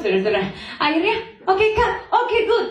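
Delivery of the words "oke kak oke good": high-pitched imitation of an approving director; delighted director's approval. Director praising the actress after the take, delighted. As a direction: cheer the take as a pleased director. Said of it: "Sampai ditepuk tangannya sama sutradara".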